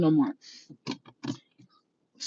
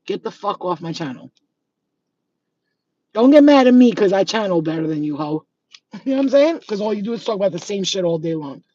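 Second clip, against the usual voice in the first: usual speaking voice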